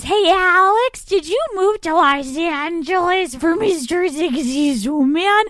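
loud, high mock fan; curious fan voice. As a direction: ask like an eager fan. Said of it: "questions that I am getting".

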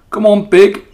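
loud, high rough farmhand voice; farmhand call. A narrator's reading. Farmhand calling the pig to breakfast, loud. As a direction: holler it like a farmhand at feeding time. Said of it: "said Lurvy".

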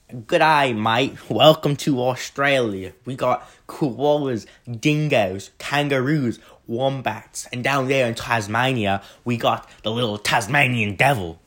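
a tourist-guide Australian welcoming you in; Australian accent. Retold or imitated. Vlogger welcoming viewers to Australia, in a mock Australian accent. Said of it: "I can't do an Australian accent".

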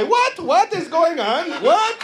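put-on high-pitched, baffled Indian accent; mock Indian accent. Indian guy baffled by what's going on, mock Indian accent. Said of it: "Indian guy".

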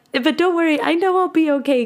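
high-pitched, retold brave reassurance; imitating yourself putting on a brave voice; tearful reassurance. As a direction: reassure them in a high little voice. Reassuring friends while crying, retold.

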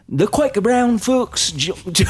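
high slow shouted Australian, hammed-up; Australian accent. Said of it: "pretend like you're at a Hillsong concert".

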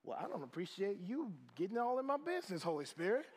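swooping, put-on indignant voice; comic huffy retort with a rising end; sassy mock offence. Pastor telling the Holy Spirit off, sassy mock offence.

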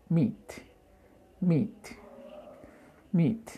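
MEAT repeated high and falling, final t released; final T pronounced. Shown as a demonstration. Teacher drilling learners, MEAT with sounded final t. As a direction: say each MEAT separately, ending on a clear t. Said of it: "pronunciar esa T al final".

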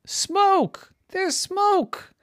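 very high-pitched cry of discovery; alarmed cry. A narrator's reading. Ducky spots smoke from the air, crying out in alarm. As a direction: call out the danger, voice leaping up.

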